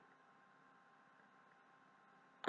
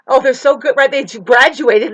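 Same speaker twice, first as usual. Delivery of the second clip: sarcastic mock praise; 7 semitones higher, 13 dB louder than usual. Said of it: "they all wanna know".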